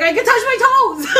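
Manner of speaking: high, loud, amazed cry; joyful disbelief. Retold or imitated. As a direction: announce it like a miracle. Joyful retold discovery.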